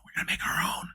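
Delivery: whispered echo of the line; mock whisper. Vlogger mocking Disney, repeating the line in a whisper. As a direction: whisper it like a secret plan.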